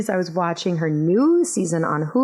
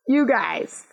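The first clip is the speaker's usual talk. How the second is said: laughing, much higher; 8 semitones higher than usual. Real, not acted. Host laughing to listeners over a kid's compliment. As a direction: giggle out the words with delight.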